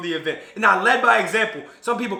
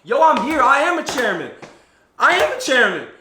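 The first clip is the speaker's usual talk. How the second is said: higher, louder boast; triumphant proclaiming; 6 semitones higher, 4 dB louder than usual. Retold or imitated. Imagining his future self, triumphant boast. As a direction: announce it like a victory.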